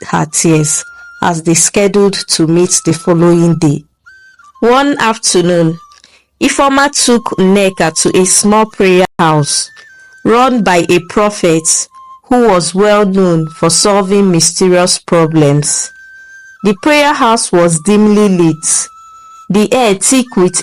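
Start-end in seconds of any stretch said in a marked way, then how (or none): none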